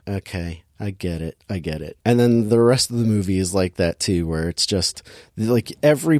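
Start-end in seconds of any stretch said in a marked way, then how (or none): 0.1-2.0 low, soft, fed-up acknowledgement; mock weary acceptance. Viewer reacting to a heavy-handed girl power song, mock weary. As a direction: grudgingly concede under your breath, voice dropped low.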